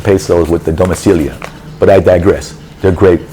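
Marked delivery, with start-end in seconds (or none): none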